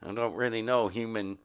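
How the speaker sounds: low, soft alien grumble; grumbling alien. Acted. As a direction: mutter like a disgruntled alien. Alien muttering after.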